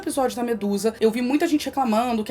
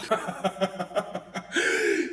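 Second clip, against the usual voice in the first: low, breathy villain laugh; evil laugh; 4 semitones lower, 7 dB quieter than usual. Acted. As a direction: cackle like a smug war god. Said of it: "ele dá uma risada maléfica".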